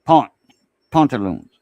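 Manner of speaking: pont alone, then pantaloons starting with that same "ah"; French pronunciation. Shown as a demonstration. Summing up the lesson for friends, French-style "pantaloons". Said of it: "It's a pont instead of pant".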